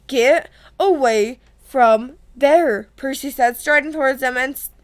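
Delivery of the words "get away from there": each word separated and drawn out; slow stern order. A narrator's reading. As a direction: command it word by word, very slowly. Retake of Percy's order, word by word.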